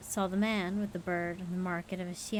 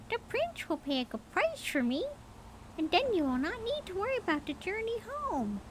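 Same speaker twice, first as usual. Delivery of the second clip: high bird voice; 12 semitones higher than usual. A narrator's reading. Mynah coaxing its owner, high bird voice. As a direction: chirp it out like a talking bird.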